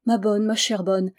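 usual speaking voice

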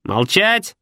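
a hidden creature barks an order; shout from under bed. A narrator's reading. Voice from under the bed shouts at them. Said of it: "заорали из-под кровати".

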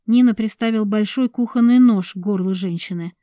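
ordinary delivery, nothing marked

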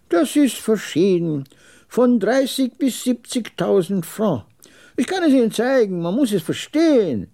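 put-on high-pitched old man explaining; high, knowing trader's voice; old camel trader, superior.

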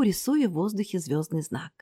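ordinary delivery, nothing marked

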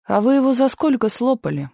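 persistent needling. A narrator's reading. Hungry Misha keeps grilling the sergeant about rations, needling. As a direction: keep pressing, mildly accusing. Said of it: "не успокаивался Миша".

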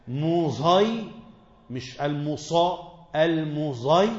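المزي with ص tinged toward ز; sad blended with zay. Shown as a demonstration. Teacher demonstrating how خلاد reads المسيطرون, with ص tinged toward ز. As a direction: blend the ص into a ز sound. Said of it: "بصاد مشمًا زايا".

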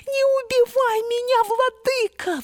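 very high squeaky mouse voice; tiny pleading mouse. A narrator's reading. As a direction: beg like a tiny frightened mouse. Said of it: "взмолилась мышка".

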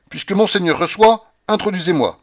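firm. A narrator's reading. Narrator demands the abbé admit him, firmly. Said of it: "je lui dis avec fermeté".

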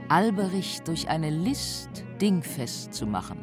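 ordinary talk

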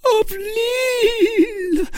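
very high, loud, slow shriek; Milady clinging to the wall in terror; terrified cry. A narrator's reading. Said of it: "a prey to insensate terror".

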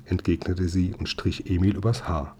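ordinary delivery, nothing marked

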